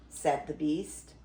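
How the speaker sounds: plain reading voice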